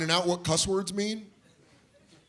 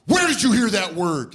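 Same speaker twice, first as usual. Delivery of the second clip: higher, louder angry demand; mad dad; 6 semitones higher, 7 dB louder than usual. Retold or imitated. Angry dad questioning kid in kitchen, loud demand. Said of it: "and he's like".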